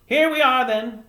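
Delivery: high, loud fisherman voice; folksy old man calling out arrival; old fisherman, arriving. Acted. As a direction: announce it heartily as an old sailor. Fisherman announces arriving home.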